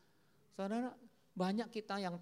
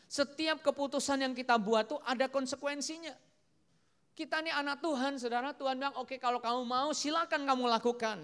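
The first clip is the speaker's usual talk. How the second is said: loud, raised sermon delivery; forceful preaching; 6 semitones higher, 8 dB louder than usual. Acted. Preacher teaches congregation that decisions have consequences, forceful preaching. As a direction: drive the lesson home to the whole hall.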